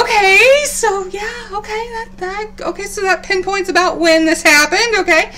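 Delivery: giddy, doing the math aloud; flustered excitement. Retold or imitated. Expectant mother working out dates, flustered and high. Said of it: "so I'm like".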